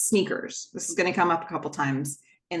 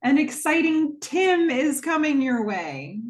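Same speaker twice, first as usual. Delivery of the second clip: fortune reading; 8 semitones higher, 3 dB louder than usual. Retold or imitated.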